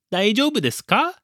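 put-on high, quick little-girl question; small voice asking with a rising end; tiny child's voice. A narrator's reading. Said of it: "A tiny voice prodded".

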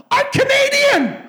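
high, loud, slow outburst; blurted protest. Retold or imitated. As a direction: blurt it out in disbelief. Blurted reply to officer.

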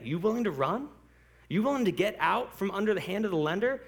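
ordinary delivery

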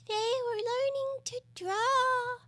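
high, slow, dozy creature; yawning, very sleepy. A narrator's reading. Dormouse continues the story, yawning sleepily. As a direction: drift through it half asleep. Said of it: "yawning and rubbing its eyes".

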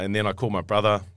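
ordinary delivery

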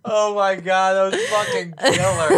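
high, laughing through the words; co-host laughs about the prank call, delighted; laughing while talking. Real, not acted. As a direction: gasp it out mid-laugh.

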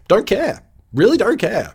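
high, loud brush-off; dismissive Blair. Acted. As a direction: throw it away emphatically, pitch jumping up. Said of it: "Blair's like".

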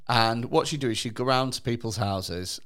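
ordinary talk

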